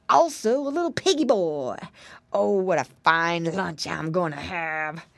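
a greedy wolf licking his lips. A narrator's reading.

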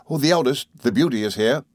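usual speaking voice

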